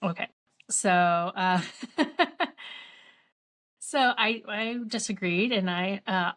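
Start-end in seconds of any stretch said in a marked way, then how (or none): 1.7-2.8 high chuckle; short run of chuckles mid-story; laugh. Real, not acted. Chuckles while telling the story.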